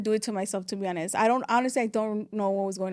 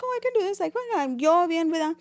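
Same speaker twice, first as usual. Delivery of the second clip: much higher, breezy self-assurance; mock confident; 6 semitones higher than usual. Alone in new apartment, telling herself, high breezy confidence. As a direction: chirp it up high like nothing's wrong. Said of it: "I'm like".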